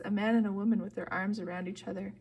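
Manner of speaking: ordinary delivery, nothing marked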